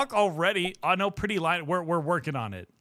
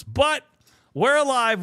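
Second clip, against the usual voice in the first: higher, louder declaration; triumphant; 7 semitones higher, 6 dB louder than usual. Real, not acted. Streamer tells chat they survived the store, triumphant. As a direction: proclaim survival. Relief after grocery story.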